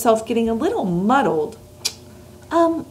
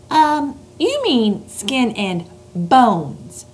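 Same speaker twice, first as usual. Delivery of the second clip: put-on slow, gentle girl correcting; girl's voice; 4 semitones higher, much slower than usual. A narrator's reading. Sophie gently correcting the giant, slow. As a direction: correct him kindly.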